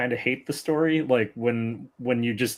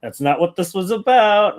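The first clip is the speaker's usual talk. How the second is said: higher, louder puzzled protest; mock baffled viewer; 8 semitones higher, 5 dB louder than usual. Podcaster acting out a confused viewer at the ending, baffled. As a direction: imitate a viewer thrown by the twist.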